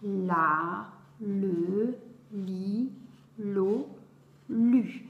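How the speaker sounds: syllables chanted one by one, evenly spaced; ritournelle. Shown as a demonstration. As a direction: recite like a classroom drill, pausing between syllables. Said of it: "on va faire donc en ritournelle". (syllabes récitées en ritournelle, bien détachées)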